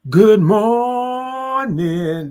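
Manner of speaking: long, slow, loud sing-song greeting; drawn-out morning greeting. Acted. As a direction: stretch every sound like a morning show host. Signature greeting repeated.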